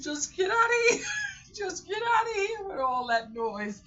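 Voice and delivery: very high, loud, laughing squeal; mock exasperated shout. Throwing out a noisy crying child, hammed-up high shout. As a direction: squeal it in comic desperation.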